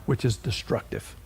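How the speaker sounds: solemn quiet warning; low hushed warning. Real, not acted. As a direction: let it sink to near silence. Sermon point dropping low.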